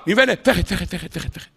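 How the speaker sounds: words fade into a distant echo; fading far-off echo. Retold or imitated. Rabbi imitating the prophet's cry echoing far away, fading. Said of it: "עוד פעם".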